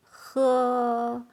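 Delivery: "喝" long and drawn out with a breathy h; like drinking something. Shown as a demonstration. Teacher teaching the word for drink, "喝" drawn out. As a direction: stretch "喝" on a rush of air. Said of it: "sounds like you are drinking something".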